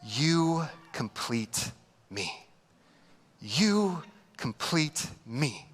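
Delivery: hammed-up romantic lead; mock movie romance. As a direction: drag it out like a sappy film line. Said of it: "that scene in Jerry Maguire".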